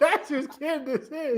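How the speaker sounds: high, slow laughing declaration. Real, not acted. Co-host lands the joke about the kid's parents, laughing. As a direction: squeeze it out slowly through laughter.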